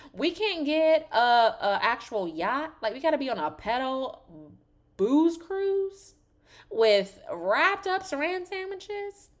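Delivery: high, loud, drawn-out disbelief; incredulous rant. Real, not acted. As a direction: push the pitch right up in outraged disbelief. Incredulous rant about cheap boat trip.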